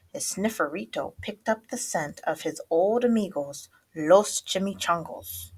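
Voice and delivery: usual speaking voice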